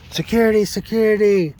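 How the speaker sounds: high, loud mock yell for security; retold scream. Cecilia screaming for hospital security, a high retold yell. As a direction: shout it out like calling the guards. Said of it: "Cecilia started screaming".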